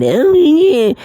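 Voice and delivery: mock a crybaby telling on someone to teacher; squeaky tattletale whine, hammed-up for ridicule; whiny mocking voice. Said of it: "Whiny, whiny, whiny, sob story".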